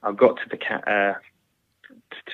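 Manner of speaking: usual speaking voice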